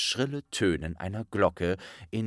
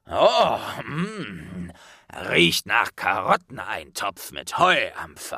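half-giant voice; 5 dB louder than usual. A narrator's reading.

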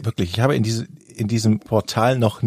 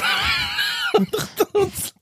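shrieking laugh; 3 dB quieter than usual. Real, not acted. Laughing at beep-laden script.

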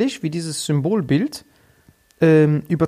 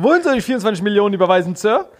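eager employee; 5 semitones higher, 4 dB louder, faster than usual. Retold or imitated. Employee voiced in acted scene.

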